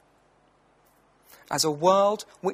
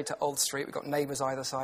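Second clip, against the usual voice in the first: ordinary talk, nothing marked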